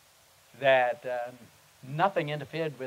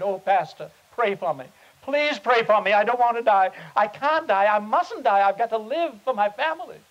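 high, loud desperate plea; a frightened sick man begging his pastor, loud and high; emphatic pleading; 6 semitones higher, 6 dB louder than usual. Retold or imitated. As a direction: beg the pastor to pray, voice raised. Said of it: "he was so emphatic about it".

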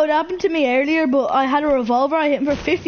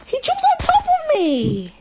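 startled; 13 semitones higher than usual. Real, not acted. Startled by enemy.

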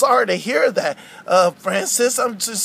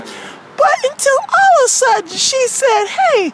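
earnest puppet character, higher than the host; character storytelling voice; aggrieved Wendy; 13 semitones higher than usual. Acted. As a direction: recount it as a wronged cartoon girl. Wendy tells of the trip offer.

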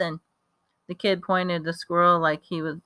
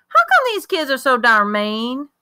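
put-on eager country boy; 6 semitones higher, 4 dB louder than usual. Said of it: "jabbering a mile a minute".

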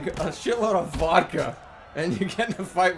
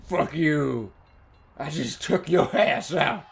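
tough-guy taunt; 7 semitones lower than usual. Pretend. Voicing his fighter taunting opponent.

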